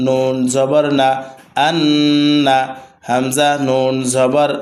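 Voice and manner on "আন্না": আন্না with the doubled ন hummed through the nose; with ghunna. Shown as a demonstration. Teacher showing students আন্না with its double ন held as a nasal hum. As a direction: hold the ন্ন of আন্না as a nasal hum before the আ. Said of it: "ওয়াজিব গুন্না বলে".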